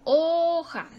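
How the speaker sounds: Hoja with a long, high first syllable; teacher drawing out HO in Hoja; stress on HO. Shown as a demonstration. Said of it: "ahí está lo más fuerte".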